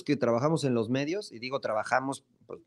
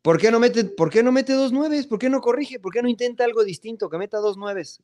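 mocking pundit voice; 9 semitones higher than usual. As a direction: parody a know-it-all sports commentator. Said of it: "los comentarios es de". (imitación burlona de comentarista, aguda y quejosa)

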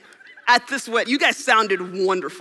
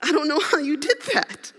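baffled; 5 semitones higher than usual. Retold or imitated. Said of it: "I'm like".

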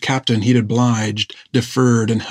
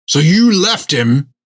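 accusing; 6 semitones higher, 5 dB louder, slower than usual. A narrator's reading. Jack accuses Finn.

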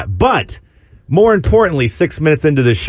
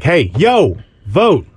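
bright, higher call-out; friendly reminder; 4 semitones higher, much slower than usual. Shown as a demonstration. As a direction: nudge them cheerfully to vote. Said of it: "it's like a reminder".

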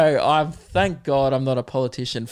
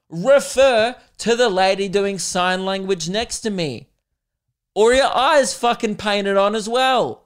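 higher, louder exasperated rant; imagined angry outburst; 5 semitones higher, 4 dB louder than usual. Pretend. The premier telling off journalists, loud and exasperated. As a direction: let the premier finally snap. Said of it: "all he wants to say is".

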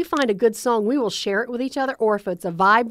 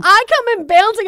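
bouncy excitement; 10 semitones higher, 8 dB louder than usual. Real, not acted. Bouncing into the office.

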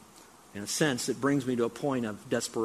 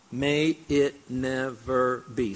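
slow emphatic scripture; much slower than usual. Shown as a demonstration. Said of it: "Say it with me out loud".

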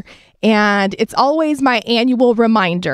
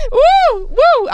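emotional exhale; 3 dB louder than usual. Real, not acted. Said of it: "let me get myself together".

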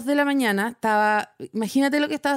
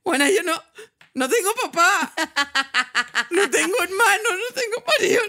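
rising higher and higher, cracking into laughter and near-tears; laughing helplessly; 7 semitones higher than usual. Retold or imitated. Friend retelling the absurd phone call, laughing till she almost cries. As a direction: blurt it out between bursts of laughter, half sobbing. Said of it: "me empezó a contar esta historia cagada de la risa".

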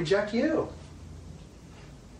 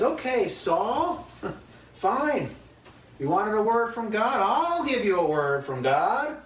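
God's mock reply; 8 semitones higher, slower than usual. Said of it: "this is the, the [name] paraphrase".

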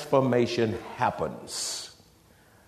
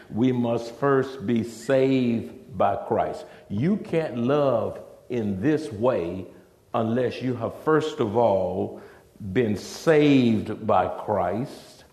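slow emphatic preaching; slower than usual. Real, not acted. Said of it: "slow emphatic repetition".

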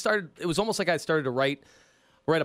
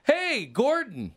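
slow, higher familiar greeting; chummy racketeers; 7 semitones higher, much slower than usual. Acted. Racketeers greet the cop they know, high drawn-out chummy hello. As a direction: stretch out a friendly hello to a pal. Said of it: "they look at me and go".

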